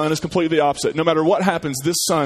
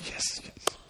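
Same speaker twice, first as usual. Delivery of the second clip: breathless whispered yes, yes; excited whisper; 15 dB quieter than usual. Acted. Optimistic kid cheering under his breath. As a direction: whisper the yeses in glee. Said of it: "He's just really pumped".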